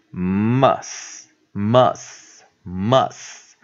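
MUS very slowly, holding the m and s; drawn-out pronunciation demo. Shown as a demonstration. Teacher showing the short U, slow pronunciation demo. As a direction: stretch MUS with a short "uh" vowel, pause between repeats. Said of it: "U的短母音念呃".

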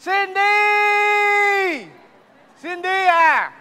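long high shouted call of a name; hollering across to her; desperate husband calling Cindy. Acted.

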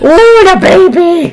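high, slow and loud, melting at the puppy; gushing over a puppy. Real, not acted.

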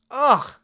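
loud, high groan of disgust; disgusted ugh. Acted. Puck griping to the listener about the couple, disgusted ugh. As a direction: throw out an exasperated ugh.

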